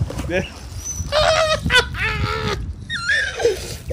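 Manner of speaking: high, long squealing laugh of joy; ecstatic laugh. Real, not acted. Finally recovering the stolen Raphael, long high squeal. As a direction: squeal with long-awaited delight. Reveals the figure he waited for.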